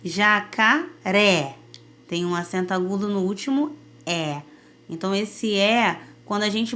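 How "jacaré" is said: jacaré said slowly, open stressed final é; teacher stretches out jacaré with an open é at the end; slow demo word. Shown as a demonstration. Teacher showing students the open accented vowel, slow and clear. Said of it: "Por exemplo, a palavra".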